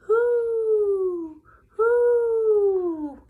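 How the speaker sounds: high, loud hooting owl. A narrator's reading. Narrator hooting like an owl in the dark. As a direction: hoot long and eerie. Ghostly calling in the night.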